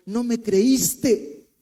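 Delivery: God telling him he didn't believe; slow reproach. Retold or imitated. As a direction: let each word land as a rebuke. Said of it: "me dijo".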